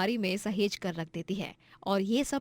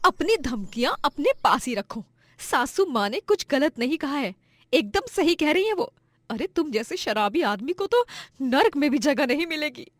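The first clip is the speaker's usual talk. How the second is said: raised-pitch indignant comeback; defiant retort; 5 semitones higher, 4 dB louder than usual. Acted. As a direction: stand up to the bully, indignant. Wife defies threatening husband.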